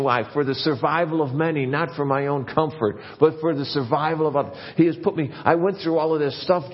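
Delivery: impassioned sermon voice at full force; preaching. Acted. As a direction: lift it up and drive it home to the church.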